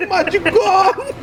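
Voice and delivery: high, drawn-out mock lament; mock wail. Host acting out the clip's punchline, mock wailing. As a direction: wail it slowly, hamming up the loss.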